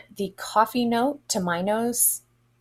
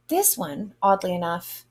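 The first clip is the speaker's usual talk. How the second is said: normal talking voice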